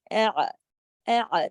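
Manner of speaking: اع with alif then ain joined; hamza then ain. Shown as a demonstration. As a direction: start with alif, close in ain. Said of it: "پہلے والے الف کی آواز نکلے". (اع میں الف کے ساتھ عین)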